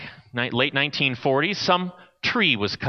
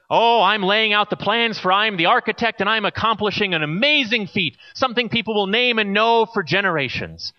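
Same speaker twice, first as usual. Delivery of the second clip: higher, lightly voiced proud architect; 6 semitones higher than usual. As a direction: boast a little as the architect. Proud architect explains his work.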